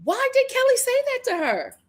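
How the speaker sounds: high-pitched, baffled wail of a question; exasperated disbelief. Real, not acted. Host asking cohost about Kelly's remark, exasperated disbelief.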